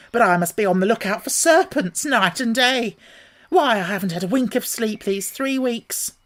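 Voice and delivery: high, put-upon bird voice; fretful pigeon voice. A narrator's reading. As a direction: complain like a harried mother bird. Pigeon complaining in story.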